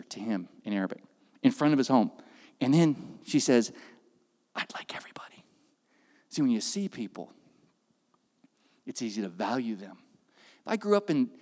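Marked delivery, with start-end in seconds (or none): 4.6-5.4 soft unvoiced whisper; whispered request. Wife quietly asks for a group picture, whispered. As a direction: whisper it shyly.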